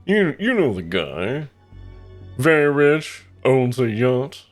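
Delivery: slow, high, loud rich snob; yacht guy voice. Shown as a demonstration. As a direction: talk through the teeth like a yacht owner. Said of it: "Yacht guy is this".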